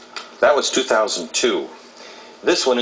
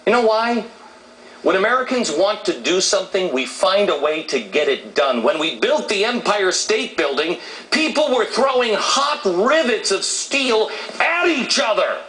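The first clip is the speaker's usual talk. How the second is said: high-pitched, rousing rant with stretched key figures; fired-up, emphatic public speaker; impassioned oratory; 13 semitones higher than usual. Real, not acted. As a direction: hammer out each fact like a rally speech. Impassioned televised monologue.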